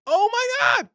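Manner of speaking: high squeal of delight; excited. Real, not acted. Excited about truffle sauce.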